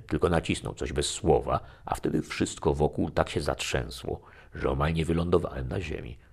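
ordinary delivery

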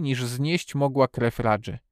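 plain reading voice

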